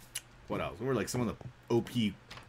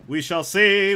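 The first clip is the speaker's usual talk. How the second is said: louder, higher playful repeat; playful declaration; 7 semitones higher, 8 dB louder, slower than usual. Pretend. Streamer teasing viewers about the creative realm, playful declaration. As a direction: sing out the line, raised and loud.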